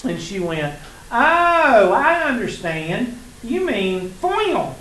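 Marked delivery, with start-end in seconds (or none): 1.1-4.8 "foil" drawn out slowly, the o stretched long after a high "Oh"; a high, loud "Oh", then a slow, stretched "I understand. You mean foil."; mock drawn-out realization. Teacher imitates student drawing out "foil". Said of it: "that's the way she said it".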